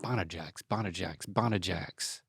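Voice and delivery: low, soft repetition; pondering it very quietly; musing to oneself. Real, not acted. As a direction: turn the word over under your breath. Mulling the word to himself.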